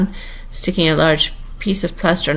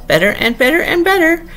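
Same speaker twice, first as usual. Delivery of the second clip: rising to a squeak; 10 semitones higher, 3 dB louder than usual. A narrator's reading. Queen carried away arguing with Alice, rising to a squeak. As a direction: voice climbing higher with each "better". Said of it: "went higher with each better".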